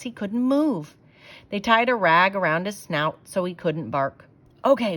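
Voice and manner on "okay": usual speaking voice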